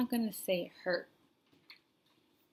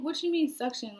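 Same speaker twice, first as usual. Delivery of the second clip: confused surprise; 6 semitones higher, 8 dB louder than usual. Retold or imitated. Confused patient questions the doctors.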